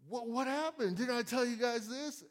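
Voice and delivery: high, exasperated what happened; retelling a boss's indignant outburst; indignant surprise. Retold or imitated. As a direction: reenact catching the stained blind out front. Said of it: "I'm like".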